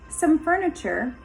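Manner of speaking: ordinary delivery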